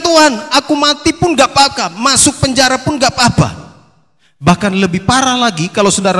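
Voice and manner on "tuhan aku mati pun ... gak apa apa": hammed-up heroic promise; fervent vow. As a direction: pledge it with over-eager zeal. Peter's bold vow acted.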